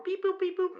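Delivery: very high, chirpy mechanical beep-boops; robot beeps. Pretend. Mocking a TSA screening machine with high robotic beeps.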